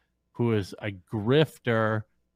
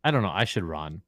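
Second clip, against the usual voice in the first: normal talking voice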